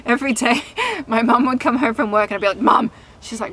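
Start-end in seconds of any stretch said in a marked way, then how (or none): 2.6-2.9 calling to mum. Retold or imitated.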